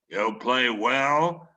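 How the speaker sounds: drawn-out skeptical boss question; imitating a blunt editor, slowly; Bradlee voice.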